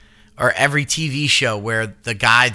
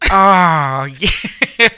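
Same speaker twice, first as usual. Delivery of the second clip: louder, drawn-out sultry oh yeah; Jennifer Coolidge voice; 3 semitones higher, 4 dB louder than usual. Retold or imitated. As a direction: breathe it like the diva. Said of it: "as I'm doing".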